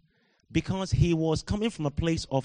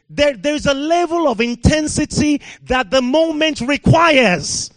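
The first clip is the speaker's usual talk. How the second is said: preaching at full force; 7 semitones higher, 8 dB louder than usual. Real, not acted. Preacher rousing the British church, loud full-force preaching. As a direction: thunder it at the congregation.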